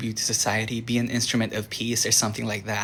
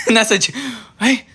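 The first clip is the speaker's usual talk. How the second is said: sudden high laughing yelp; startled laugh; 11 semitones higher, 6 dB louder than usual. Real, not acted. Vlogger startled by a noise in the condo, laughing. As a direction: caught off guard by a noise.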